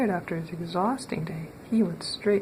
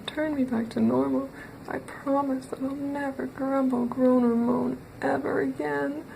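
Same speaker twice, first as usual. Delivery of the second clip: higher, squeaky pleading voice; pleading pig; 6 semitones higher than usual. Acted. Mister Grumble pleading with the wizard in a higher pig voice. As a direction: beg like a frightened little pig. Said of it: "begged Mister Grumble".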